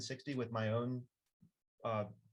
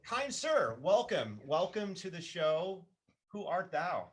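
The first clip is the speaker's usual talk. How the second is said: higher-pitched mock-archaic welcome; hammed-up herald; 5 semitones higher, slower than usual. Host welcoming a mysterious guest to the show, hammed-up formality. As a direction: play a grand old-time show host greeting a guest.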